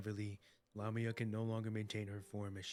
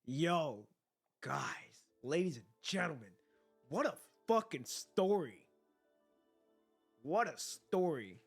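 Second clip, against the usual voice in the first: hyped; 3 semitones lower, 7 dB louder, slower than usual. Real, not acted. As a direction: rave about it. Hyped reaction to ending.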